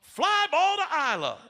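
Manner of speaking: high, loud coach calling the play. Retold or imitated. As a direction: announce the pretend fly ball like a coach. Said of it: "Coach is like".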